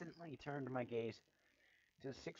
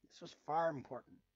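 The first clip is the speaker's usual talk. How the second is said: plain reading voice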